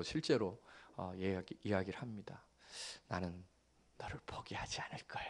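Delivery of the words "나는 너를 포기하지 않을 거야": soft, breathy whisper of a promise; bedside whisper. Retold or imitated. Father whispering a promise to his sleeping child. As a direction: whisper it so she stays asleep.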